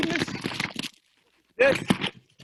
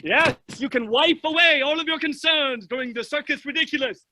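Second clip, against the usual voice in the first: normal talking voice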